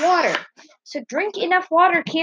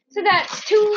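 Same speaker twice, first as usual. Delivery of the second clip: called out; 6 semitones higher, slower than usual. Real, not acted. Counting people, calls it out.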